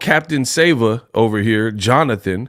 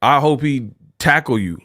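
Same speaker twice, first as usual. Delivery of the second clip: usual speaking voice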